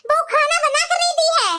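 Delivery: scolding mother-in-law. Acted. Mother-in-law complaining to her daughters-in-law about late food, nagging. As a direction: grumble like a bossy saas.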